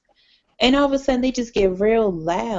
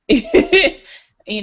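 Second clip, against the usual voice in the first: laughing; 11 semitones higher, 7 dB louder than usual. Real, not acted.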